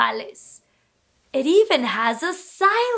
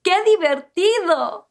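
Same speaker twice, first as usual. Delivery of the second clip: ordinary talk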